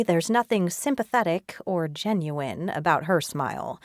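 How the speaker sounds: ordinary delivery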